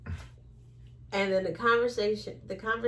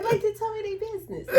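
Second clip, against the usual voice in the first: laughing through the words; high giggly laugh as she talks; 12 semitones higher, faster than usual. Real, not acted. Telling her cohost, laughing.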